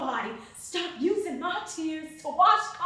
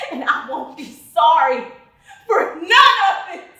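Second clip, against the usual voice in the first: loud defiance; 2 semitones higher, 10 dB louder than usual. Acted. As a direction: belt it out, punching "sorry" and "none". Defiant vow repeated after screaming threat.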